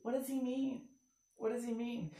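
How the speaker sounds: very soft, puzzled murmur; hushed little question; confused girls. Retold or imitated. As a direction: imitate a baffled audience member under the breath. Imitates confused girls in audience.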